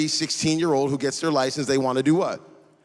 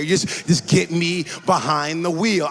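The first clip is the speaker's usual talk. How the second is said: ordinary talk, nothing marked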